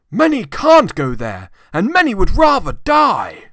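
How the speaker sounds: high, loud protesting cry; indignant gentleman. A narrator's reading. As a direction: burst out against Scrooge's cruelty.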